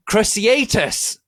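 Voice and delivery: slow, loud, high spell scream. Acted. Harry casting a curse at Draco, drawn-out scream. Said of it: "screamed Harry".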